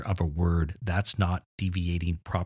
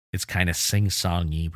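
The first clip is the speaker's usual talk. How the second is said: ordinary talk, nothing marked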